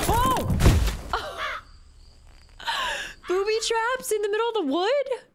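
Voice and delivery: high startled laugh into words; giggling disbelief at the trap; laughing surprise. Real, not acted. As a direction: laugh through the shock.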